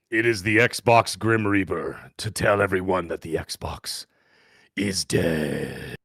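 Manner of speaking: low, slow grim reaper voice; ghost voice. Acted. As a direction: intone doom like a spectral reaper. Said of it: "you kinda sound like the ghost of Christmas Past".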